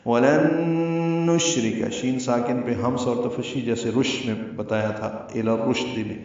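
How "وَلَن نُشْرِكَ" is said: وَلَن نُشْرِكَ slow, the نون merged with a held nasal hum; slow Quranic نون ghunna. Shown as a demonstration. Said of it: "یہاں پہ غنہ ہوگا".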